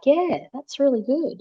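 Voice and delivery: put-on a happy small kid, unbothered; content child. Daughter pleased her uncle watches over her, slow high child voice. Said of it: "And she's like".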